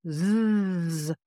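a long steady voiced zzz buzz; bee-like buzzing zzz. Shown as a demonstration. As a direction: hold a buzzing Z sound from the belly. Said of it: "as I make that sound".

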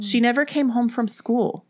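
high, anxious report; worried. A narrator's reading. Sybil's mother phoning Ellen, worried. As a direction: say it as a worried plea. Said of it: "the worried woman said".

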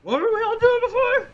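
put-on high, loud young boy's voice; eager cabin boy. Cabin boy answers the first mate, high and eager. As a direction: chirp back eagerly like a kid.

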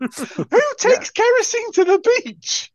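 high, drawn-out exasperated question; comic incredulity. Real, not acted. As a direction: stretch it out high in utter disbelief. Exclaiming at an absurd film detail.

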